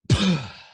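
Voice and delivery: loud release sigh with a shoulder drop; big loud exhale. Shown as a demonstration. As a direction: let it all fall out in one sigh. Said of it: "let's try it".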